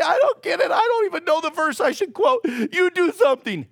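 high, laughing I-don't-get-it; laughing exasperation. Retold or imitated. Believer laughing at their own confusion in prayer. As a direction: throw up your hands with a laugh.

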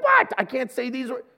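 can't believe what he's hearing; indignant disbelief. Retold or imitated. Husband told by his wife he can't talk like that, stunned. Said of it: "And I'm like".

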